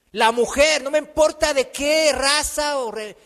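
loud, high pulpit declaration; emphatic preaching. Real, not acted. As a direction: proclaim it as God's own decision.

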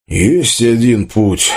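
slow, drawn-out admission; reluctant. A narrator's reading. Tolik reveals the one way out, reluctantly. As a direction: drag the words out unwillingly. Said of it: "сказал тот нехотя".

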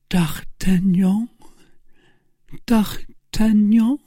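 high, slow, breathless calling; high, drawn-out, failing plea; dying cry. A narrator's reading. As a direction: call out weakly for a lover. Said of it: "cried Madame Bonacieux".

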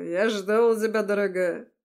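slurred, slow tipsy voice; drunk. Acted. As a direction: drag the words out like a drunk. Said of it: "заплетающимся языком".

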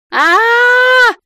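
долгий высокий крик; заори во весь голос на одной ноте; громкий вопль. A narrator's reading. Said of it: "громко заорала я". (протяжный оглушительный вопль)

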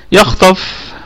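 يخطف with the خ and ط heavy; demo of the word. Shown as a demonstration. Teacher isolating the word for students. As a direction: pronounce يخطف with emphatic خ and ط. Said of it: "الخاء والطاء في الدرجة الثانية".

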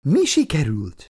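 winded after running; still panting. A narrator's reading. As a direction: ask while catching your breath. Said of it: "kérdezte Margit még mindig lihegve".

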